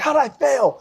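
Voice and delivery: high, outraged mock yell; indignant outburst. Marine who failed inspection, high indignant outburst. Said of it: "He's like".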